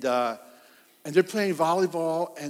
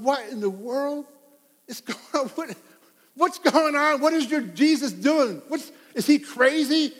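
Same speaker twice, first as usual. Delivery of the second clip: higher, louder mock outrage; scandalized Pharisees; 7 semitones higher, 3 dB louder than usual. Preacher voicing Pharisees appalled at Jesus, mock outrage, higher and louder. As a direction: play the shocked Pharisees, voice pushed up. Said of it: "they're going".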